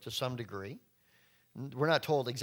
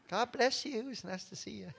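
fake cheerful greeting; 6 semitones higher, 4 dB quieter than usual. Fake smiling churchgoers after fight.

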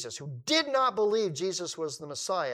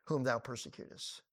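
voice of Jesus; 4 semitones lower, 5 dB quieter than usual. Acted. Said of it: "And he said unto me".

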